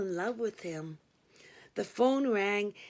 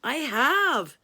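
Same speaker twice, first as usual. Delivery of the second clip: declaring; 5 semitones higher, 7 dB louder than usual. A narrator's reading. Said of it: "he declared".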